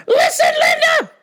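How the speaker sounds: very high, hammed-up shout for attention; exasperated shriek. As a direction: shriek it, nobody is listening. Calling escalates to a shriek.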